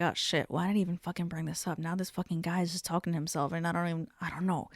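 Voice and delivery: soft, fast, hushed regret; mock muttered panic; nervous inner voice. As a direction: rush it under your breath, worried. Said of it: "she even had that face like".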